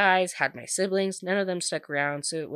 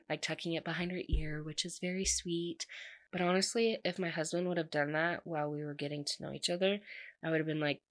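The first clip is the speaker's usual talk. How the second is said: normal talking voice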